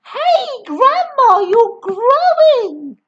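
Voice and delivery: high, loud startled shout; boy shouting in alarm at growing Grandma. Acted. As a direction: yell up at her in alarm. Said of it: "George gave a jump and shouted".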